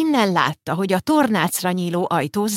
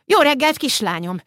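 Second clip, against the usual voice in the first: calling across; 6 semitones higher than usual. Acted. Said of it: "kiáltott át".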